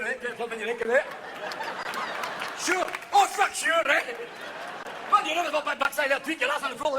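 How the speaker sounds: put-on thick drunk Scottish brogue; a belligerent drunk Scot in a pub; mock Scottish drunk ranting; Scottish accent. Drunk Scotsman speaking.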